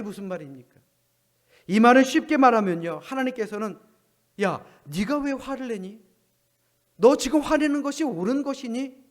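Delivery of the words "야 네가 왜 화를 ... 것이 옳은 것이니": scolding. Pretend. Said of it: "야단치셨다".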